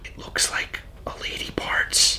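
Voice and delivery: breathy whisper. Acted. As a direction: whisper it like a secret. Whispering a rude joke.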